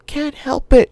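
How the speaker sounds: put-on high girl voice, whispered excuse; whispering girl. A narrator's reading. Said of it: "she whispered".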